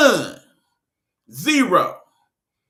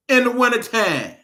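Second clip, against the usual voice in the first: ordinary talk, nothing marked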